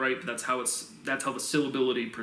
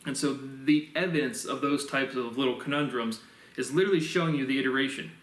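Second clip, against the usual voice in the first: normal talking voice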